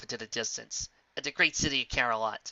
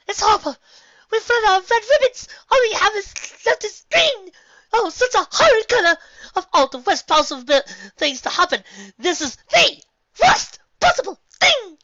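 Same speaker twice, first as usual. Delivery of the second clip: very high, loud wailing; dramatic wailing; 13 dB louder than usual. Acted. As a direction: melodramatic despair. Rarity melodramatic panic.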